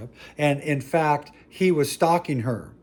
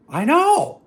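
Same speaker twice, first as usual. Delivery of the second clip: mock exclaim; 6 dB louder than usual. Mock agreement with audience.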